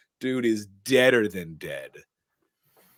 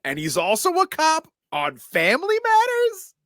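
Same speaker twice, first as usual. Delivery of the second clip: amused disbelief; 14 semitones higher, 5 dB louder than usual. Real, not acted. Joking to friends about the cop, high amused disbelief. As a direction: marvel at it up high. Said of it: "amused high incredulous".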